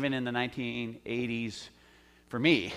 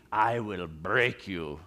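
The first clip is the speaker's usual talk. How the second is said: low, slow mock Russian accent; 5 semitones lower, much slower than usual. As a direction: imitate a Russian movie boxer, drawn out and low. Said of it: "It's my best Russian accent".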